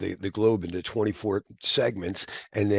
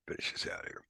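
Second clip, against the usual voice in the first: whispered aside; 14 dB quieter, faster than usual. Real, not acted. Lecturer getting back on track, whispering to himself. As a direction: murmur it almost silently.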